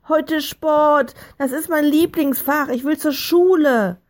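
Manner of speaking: put-on high, loud child's tantrum; furious bellowing. A narrator's reading. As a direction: yell in protest like an angry little boy. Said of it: "während er brüllte". (wütend brüllend)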